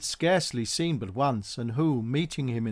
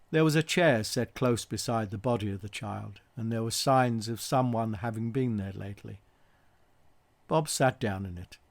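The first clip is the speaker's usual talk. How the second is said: usual speaking voice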